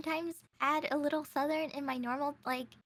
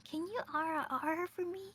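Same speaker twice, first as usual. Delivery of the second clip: imitating a fan; 4 semitones higher, 4 dB quieter, faster than usual. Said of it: "typical VTuber fan request".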